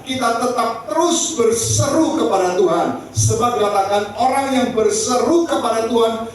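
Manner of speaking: high-pitched, urgent sermon delivery; fervent preaching. Real, not acted. Preaches with raised voice. (berkhotbah dengan berapi-api)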